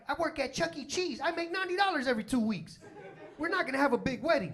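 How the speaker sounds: high-pitched protest; acted exasperated boyfriend; exasperated objection. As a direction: plead poverty at a high pitch. Broke groom objects to big wedding.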